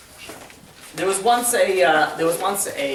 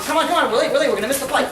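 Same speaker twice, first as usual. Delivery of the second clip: higher-pitched, impatient urging; urgent hurrying; 7 semitones higher, faster than usual. Acted. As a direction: hurry the boy along as the impatient father. Hurried father urging his son.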